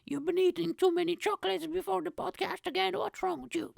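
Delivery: high, incredulous listener voice; a listener scolding Mike for his chocolate habit, in a high mocking voice. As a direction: play a scoffing listener teasing the host. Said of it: "So you'll be like".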